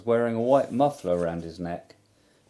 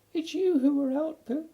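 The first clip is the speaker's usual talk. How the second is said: very high, small squeaky voice; tiny piglet talking up to his friend; Piglet's squeak. Acted. Piglet correcting Pooh, tiny squeaky voice.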